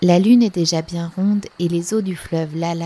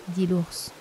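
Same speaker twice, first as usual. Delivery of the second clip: ordinary delivery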